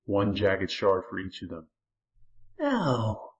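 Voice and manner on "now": musing. A narrator's reading. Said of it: "the Lurking seemed to consider".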